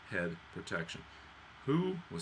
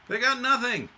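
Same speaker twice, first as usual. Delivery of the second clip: indignant exclamation; 13 semitones higher, 9 dB louder than usual. Acted. Mock outrage for Russians.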